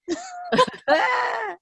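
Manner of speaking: high, drawn-out mock laugh; imitated weird laugh. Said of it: "a weird laugh".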